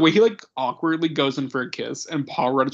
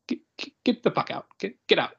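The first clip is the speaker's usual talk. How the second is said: stammered dismissal, imitated; 4 semitones higher than usual. Acting out Favreau's stammered dismissal.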